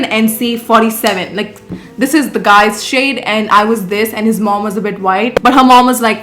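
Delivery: ordinary talk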